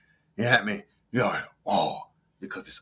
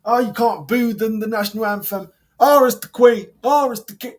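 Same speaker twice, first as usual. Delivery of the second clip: high, louder sarcastic parody of patriots; mocking patriots; 9 semitones higher, 7 dB louder than usual. Vlogger mocking patriotic fans, high sarcastic parody. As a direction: whine it like a smug flag-waver. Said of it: "You like to get patriotic".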